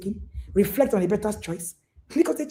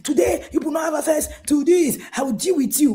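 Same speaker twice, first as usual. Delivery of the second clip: mock threatening parent; 7 semitones higher than usual. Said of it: "don't call your children".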